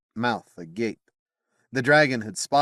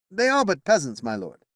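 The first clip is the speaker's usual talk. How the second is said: higher, scornful of peasants; dismissive knight; 10 semitones higher, 3 dB louder than usual. Acted. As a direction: brush the peasants aside. Bedivere voiced in narration.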